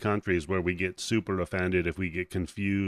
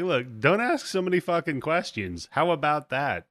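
raised-pitch, irritated warning in character; annoyed telling-off; 7 semitones higher than usual. Acted. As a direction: act out fed up with the questions. Hunters tell hero off.

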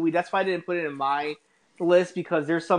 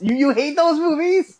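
surprised; 12 semitones higher, 5 dB louder than usual. Real, not acted. Surprised at friend's dislike.

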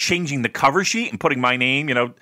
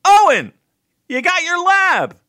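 much higher, louder call, the last words slowed; teacher calling out; 6 dB louder than usual. Retold or imitated. Chemistry teacher asking lazy student for his lab, high and loud. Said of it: "He'd be like".

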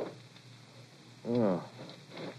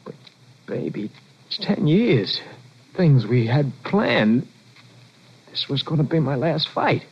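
pleading; 5 semitones higher than usual. Acted. Husband pleads with leaving wife.

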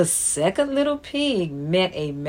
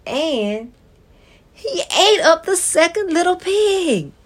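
high, louder dramatic telling; 10 semitones higher, 4 dB louder than usual. Acted. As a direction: build to a gasp. Wolf eats pig, dramatic.

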